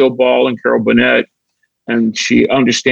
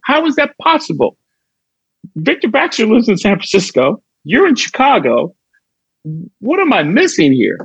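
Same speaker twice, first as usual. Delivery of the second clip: higher-pitched, replayed disbelief; incredulous; 8 semitones higher than usual. Actor challenging Raven on how he fits, baffled. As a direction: puzzle it out aloud in mock protest. Disputing the show's premise, baffled.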